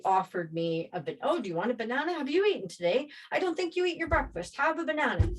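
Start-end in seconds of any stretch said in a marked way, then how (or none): 1.2-5.3 high-pitched nagging offers; pushy mock concern; imitated pestering. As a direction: mimic people fussing over someone, high voice. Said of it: "And bugging me and bugging me".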